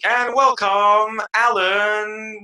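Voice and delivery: high, loud, drawn-out announcer welcome; hyped-up, stretched-out intro; show host introduction. Retold or imitated. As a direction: imitate a host's big build-up to the guest. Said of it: "And then you're like".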